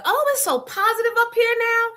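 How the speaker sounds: high gushing mock voice; mocking her gushing. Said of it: "just kept with the".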